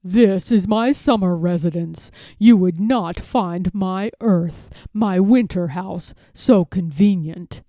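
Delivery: a fox being oily-polite to a duck; sly fox gentleman. Acted. Fox gentleman greeting Jemima, smooth and sly.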